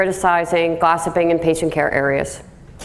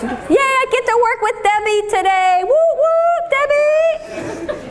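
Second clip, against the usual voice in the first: fake cheerful coworker; slower than usual. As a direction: squeal with phony joy at working with her. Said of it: "These are the ones".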